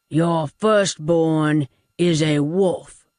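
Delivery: put-on slow, heavy old man's warning; Doolittle's voice. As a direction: spell out each word slowly like a grim verdict. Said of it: "Doolittle said".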